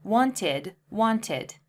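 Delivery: wanted with an extra id syllable; wanted spoken carefully as two syllables; wanted said twice. Shown as a demonstration. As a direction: model wanted clearly. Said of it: "Wanted is the past simple tense".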